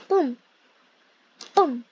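ordinary talk, nothing marked

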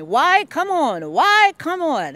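high, loud call across the garden; Mister Taylor calling to her across the garden. Retold or imitated. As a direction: call her over from a distance. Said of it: "keep calling me".

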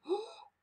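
soft, high "oh" of pretend shock; hushed playful gasp; mock surprised gasp. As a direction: gasp softly as if caught out. Teacher stages discovering her mistake.

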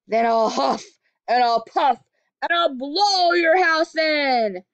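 loud wolf threat, slow at the end; wolf threatening the house of sticks, loud; wolf shouting. Acted. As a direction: bellow it like a hungry wolf. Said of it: "shouted the wolf".

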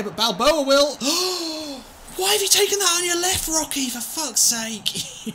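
furious disbelieving shout; frustrated shout. Real, not acted. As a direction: yell at the player for missing. Frustrated at player's miss.

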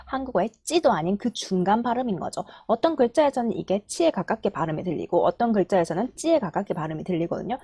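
normal talking voice

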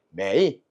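매이 with a full low dip and rise; unchanged third tone. Shown as a demonstration. Teacher repeats the unchanged tone, 매이 dipping low. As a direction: say 매이 low, dipping before it lifts. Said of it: "쉬 이렇게 해야 돼".

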